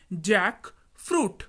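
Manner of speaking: Jackfruit drawn out as two parts, pause after जैक; slow, split word. Shown as a demonstration. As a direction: model jackfruit slowly, half by half. Teacher repeats word split for learners.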